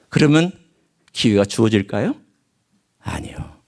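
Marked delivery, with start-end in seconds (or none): none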